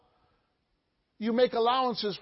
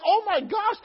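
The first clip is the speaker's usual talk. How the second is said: oh my gosh; 10 semitones higher, 5 dB louder than usual. Retold or imitated. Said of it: "I was like".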